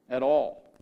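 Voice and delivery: ordinary talk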